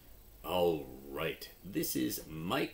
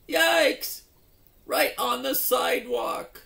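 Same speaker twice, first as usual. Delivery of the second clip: much higher and louder shriek; startled yelp; 13 dB louder than usual. Acted. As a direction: squeal as you hit the sidewalk. Mike crashes again.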